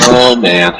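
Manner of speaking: normal talking voice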